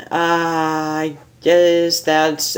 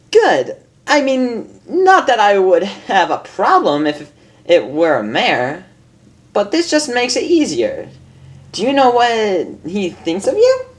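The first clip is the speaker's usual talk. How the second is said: much higher, amused lady unicorn voice; a refined mare teasing her friend, higher; Rarity; 8 semitones higher than usual. Acted. Reads Rarity's lines in voice.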